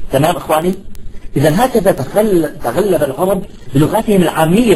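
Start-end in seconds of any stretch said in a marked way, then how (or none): none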